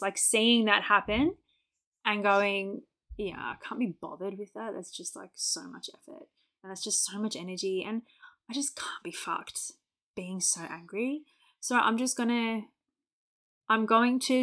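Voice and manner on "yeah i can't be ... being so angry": quietly fed up, half to yourself; weary self-talk. Retold or imitated. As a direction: trail down to a near whisper, quoting your own thought. Voicing her own weary self-talk.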